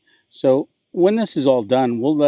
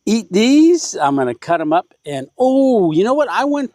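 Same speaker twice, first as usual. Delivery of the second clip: high and loud, suddenly eager; excited; 8 semitones higher, 5 dB louder than usual. Real, not acted. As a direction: burst out with an eager thought.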